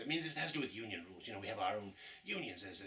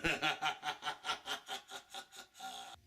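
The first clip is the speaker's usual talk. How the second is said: evil laugh; 4 semitones higher than usual. Acted. Villain laughs after line.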